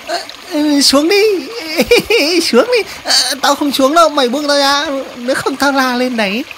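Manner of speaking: high, agitated cartoon creature voice; panicked protest. Spider threatens the crab pulling it underwater, alarmed. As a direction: struggle against being dragged, pitched right up.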